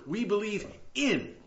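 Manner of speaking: "in" held apart at the end; stress on IN. Shown as a demonstration. Preacher shows why one simple word matters, stressing "in". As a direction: land firmly on the word "in". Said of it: "So a simple word".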